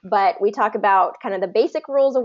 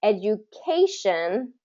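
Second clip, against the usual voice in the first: "Education" drawn out slowly, stress on CA; slow teacher's demo of "Education", edu-CA-tion; third-syllable stress; 4 dB quieter, slower than usual. Shown as a demonstration. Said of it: "is third syllable stress".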